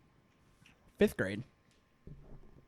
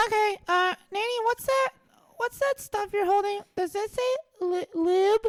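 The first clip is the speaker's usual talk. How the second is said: put-on little boy voice; 12 semitones higher, 4 dB louder than usual. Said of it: "little Joey".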